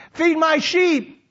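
high, drawn-out command; ringing, slow order; emphatic charge. Acted. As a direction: proclaim it as a commission. Jesus's final charge to Peter.

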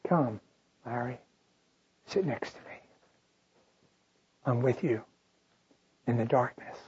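soft, low, tender invitation; gentle father. Retold or imitated. The father sits beside the frightened child, softly reassuring. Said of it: "and he says".

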